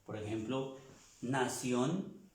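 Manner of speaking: usual speaking voice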